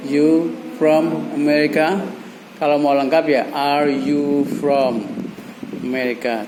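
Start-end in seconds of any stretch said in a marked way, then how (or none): 0.1-2.1 slow, measured question for repeating; drawn-out teaching example; slow model phrase. Shown as a demonstration. 3.5-6.5 very slow, drawn-out question; slow teaching example, dropping at the end; slow model phrase. Shown as a demonstration. Models the full question slowly.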